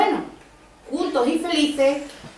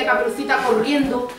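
plain reading voice

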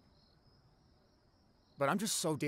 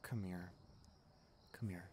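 softer and lower, drawing her in; gentle comfort; 5 semitones lower, 12 dB quieter than usual. Acted. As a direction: coax her close, tender and hushed. Comforting friend after breakup.

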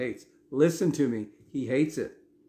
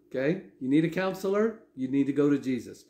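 ordinary talk

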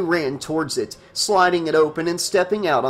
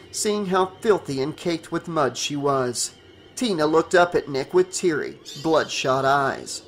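ordinary delivery

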